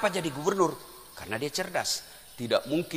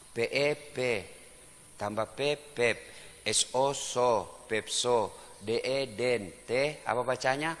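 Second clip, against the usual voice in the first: beginner reading; 5 semitones lower, 9 dB quieter, slower than usual. Retold or imitated. Acting a beginner reading aloud.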